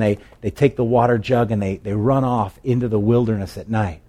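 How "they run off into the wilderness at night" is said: ordinary delivery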